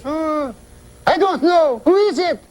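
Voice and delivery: high falsetto, puzzled wife; high woman's voice. Retold or imitated. Wife stumped by the riddle, high falsetto. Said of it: "She's a woman, high voice".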